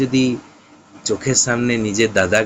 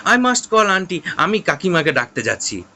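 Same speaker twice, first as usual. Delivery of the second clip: alarmed; 7 semitones higher than usual. Acted. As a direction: panic and threaten to fetch aunty. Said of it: "সিড তখন এবার বলছে".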